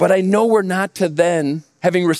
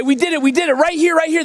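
higher, jubilant hollering; hooting celebration; 8 semitones higher, faster than usual. Retold or imitated. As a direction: holler it like a victory dance. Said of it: "They're hooting and they're hollering".

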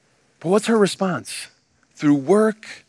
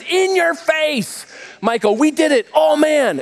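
higher, taunting victory whoop; gloating whoop; 7 semitones higher than usual. Retold or imitated. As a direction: rub it in, playfully. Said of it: "They're hooting and they're hollering".

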